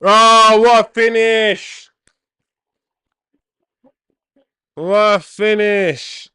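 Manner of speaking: drawn-out roaring goal celebration; long goal yell. Real, not acted. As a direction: stretch the yell out as the ball hits the net. Watching a live goal go in.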